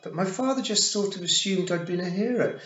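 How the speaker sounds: put-on high, loud boy in a rush of excuse; flustered blurting. A narrator's reading. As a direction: pour out a nervous justification.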